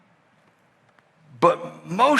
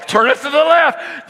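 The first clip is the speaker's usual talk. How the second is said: high, loud bark of an order; urgent shout; 10 semitones higher, 5 dB louder than usual. Retold or imitated. Dad shouting at daughter heading for pole, urgent yell. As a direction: yell it fast.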